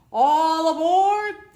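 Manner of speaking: long, high, loud drawn-out call; conductor's call. Acted. Conductor calling children aboard, loud drawn-out cry. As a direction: call it down the platform. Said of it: "the conductor cried out".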